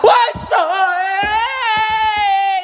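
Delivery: real panicked screaming; terrified scream. Real, not acted. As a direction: scream as the ghost appears.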